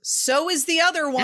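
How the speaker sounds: high, loud pointed reply; retold mom retort. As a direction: call it back across the stands. Quoting mom's loud retort.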